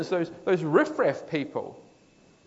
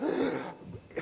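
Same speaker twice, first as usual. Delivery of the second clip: relieved gasp; 8 semitones higher, 7 dB quieter than usual. Acted. Relief of freedom.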